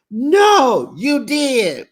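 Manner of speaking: high, loud accusing yell; retold yell. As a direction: throw it back at her, shouting. Heated hotel argument retold.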